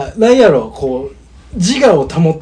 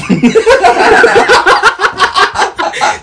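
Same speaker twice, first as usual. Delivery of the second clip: high, loud laughing fit; loud burst of laughter; 6 dB louder than usual. Real, not acted. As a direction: explode into laughter.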